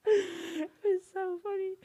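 high, slow words squeezed through laughter; real laughter. Real, not acted. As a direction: crack up while saying it. Cracking up telling her story.

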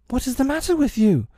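high-pitched alarmed question; suddenly afraid. A narrator's reading. Peter to the reeling fairy, suddenly afraid. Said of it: "suddenly afraid".

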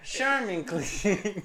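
mock ad jingle line. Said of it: "My honey's clean".

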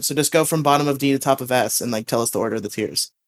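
ordinary delivery

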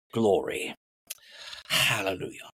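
low, slow English preacher impression; crazy English accent. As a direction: imitate an English preacher, drawing out each phrase. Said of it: "he had this crazy English accent".

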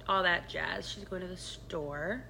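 ordinary talk, nothing marked

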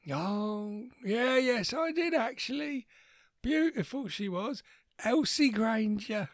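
put-on high, quavering old man voice; lightly voiced grandpa, confused and cranky; doddery old grandad. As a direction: play a confused old war veteran.

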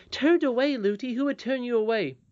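put-on high-pitched childish disbelief; astonished. Princess questioning her nurse's dismissal, astonished. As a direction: question it as a shocked little princess.

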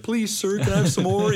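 high-pitched mock begging orphan; Oliver Twist. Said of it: "I was kinda like Oliver Twist".

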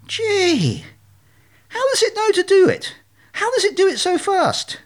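very high, wonderstruck little voice; amazed naive duckling. Acted. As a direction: marvel in a squeaky-high voice. Duckling marvels at the reflection.

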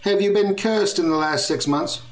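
a lightly voiced fussy receptionist.

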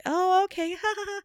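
high, anxious little laugh; high nervous voice. Retold or imitated. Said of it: "I go high when I'm in that voice because I'm always nervous".

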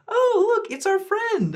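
high, bright mock-cheerful voicing of the family; cheery family voice. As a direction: chirp it sweetly like an oblivious host.

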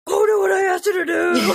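high-pitched parental shout; frustrated mock yell. Mom acting out losing it with her kids, frustrated yell. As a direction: snap like a parent who has repeated it endlessly. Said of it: "you get so frustrated and you're just like".

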